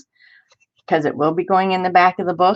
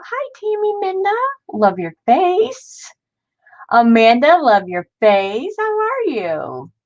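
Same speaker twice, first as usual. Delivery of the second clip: sing-song greetings; 10 semitones higher than usual. Real, not acted.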